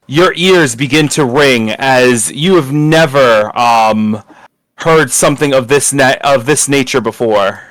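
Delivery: normal talking voice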